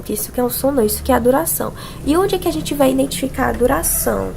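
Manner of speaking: ordinary talk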